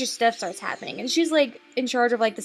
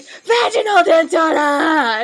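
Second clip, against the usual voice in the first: loud, drawn-out mock yell, pitched up; freaking-out shout; 5 semitones higher, 9 dB louder, much slower than usual. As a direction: shout it slowly like a panicked doctor. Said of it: "And freaking the fuck out".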